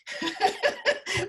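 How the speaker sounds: bursting out laughing; a big unvoiced laugh; loud laugh. Real, not acted. Host laughs at own joke.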